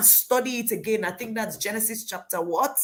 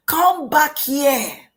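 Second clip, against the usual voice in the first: high, loud, drawn-out call; stretched-out, acted shout; commanding shout; 7 semitones higher, 8 dB louder, slower than usual. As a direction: holler slowly after someone running off. Angel calling Hagar back louder.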